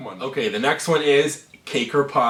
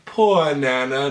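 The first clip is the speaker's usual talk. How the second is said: put-on slow, drawn-out British accent; 4 semitones higher, 5 dB louder, much slower than usual. Lightly British "Poor Nana" for YouTube viewers, slow. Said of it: "British accent".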